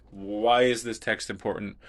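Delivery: ordinary talk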